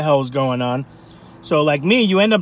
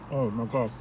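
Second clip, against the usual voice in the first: wincing from cold on teeth; pained hush after a cold bite; sensitive teeth wincing; 6 semitones lower, 9 dB quieter than usual. Real, not acted. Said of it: "I have sensitive teeth".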